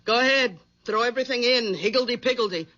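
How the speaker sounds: low, nagging housewife voice; low, bossy nagging wife. Acted. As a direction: scold him like a domineering wife.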